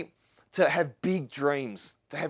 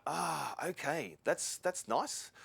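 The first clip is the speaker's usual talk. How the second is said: quiet, slow, impressed; 9 dB quieter, much slower than usual. Retold or imitated. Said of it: "And I'm like".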